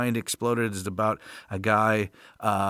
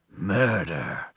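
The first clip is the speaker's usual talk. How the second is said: lower, drawn-out mock drama; mock-ominous echo; 5 semitones lower than usual. Host echoing the crime for effect, mock-ominous. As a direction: echo it as a playful dark sting.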